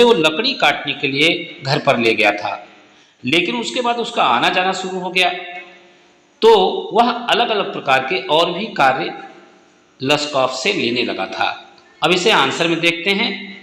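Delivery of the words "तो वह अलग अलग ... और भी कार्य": ordinary talk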